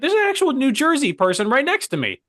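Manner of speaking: very high, strained mock objection; high-pitched protest. Employee warning boss about his neighbour, high-pitched protest. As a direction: squeal it in comic disbelief.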